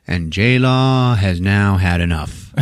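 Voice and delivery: ordinary talk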